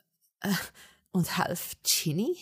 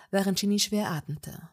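plain reading voice

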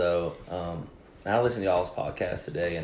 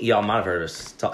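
ordinary talk

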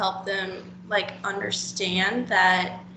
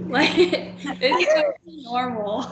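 laughing; 5 semitones higher, 5 dB louder than usual. Real, not acted. Reassuring a nervous friend.

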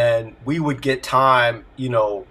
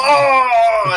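loud, high gasp of wonder; a delighted oh at the playback; mock amazement; amazed oh; 12 semitones higher, 9 dB louder than usual. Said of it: "And you go".

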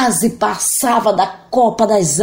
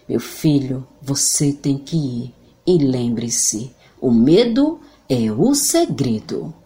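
voz de mãe grave e lenta; séria, baixa e devagar; serious mother; 5 semitones lower, slower than usual. Acted. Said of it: "olhando muito seriamente". (mãe séria e grave)

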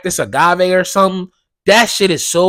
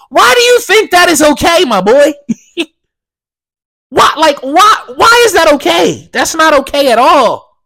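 indignant; 10 semitones higher, 6 dB louder than usual. Real, not acted. Indignant rant about Joe.

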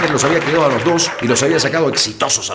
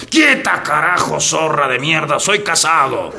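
high, full-voiced yell of refusal; drunken outraged shout; 4 semitones higher, slower than usual. Drunk husband fending off whoever undresses him, indignant shouting. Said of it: "tú has gritado ese rato".

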